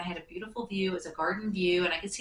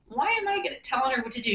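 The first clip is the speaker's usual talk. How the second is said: amused self-question; 6 semitones higher, 4 dB louder, faster than usual. Retold or imitated. Narrator's inner thought.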